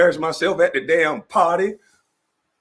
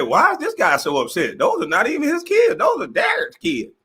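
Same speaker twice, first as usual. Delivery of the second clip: put-on squeaky kid voice; 7 semitones higher than usual. Voicing the little boy again.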